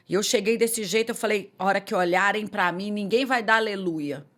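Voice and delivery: ordinary delivery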